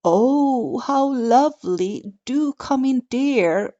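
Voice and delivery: a hint of a croaky wolf granny; wolf faking a sweet granny, croaking. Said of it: "croaked the wolf".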